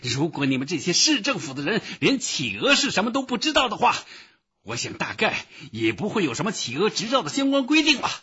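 loud, high angry tirade; enraged high-pitched yelling; furious shouting. A narrator's reading. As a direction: rant at the officials, speeding up. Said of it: "他大喊道".

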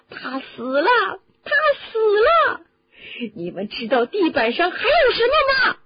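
high, loud, grief-stricken outcry; anguished shouting. A narrator's reading. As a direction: scream the news, building to a piercing accusation. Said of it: "在大家的一片惊慌的静寂中大叫".

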